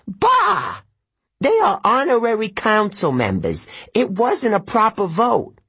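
high, loud disdainful satyr with a yelped bah; scoffing old satyr. A narrator's reading. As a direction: dismiss them huffily.

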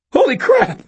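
high, loud startled shout. A narrator's reading. Cashier startled by exploding bottles, shouting. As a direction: yelp it in shock. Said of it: "shouted the cashier".

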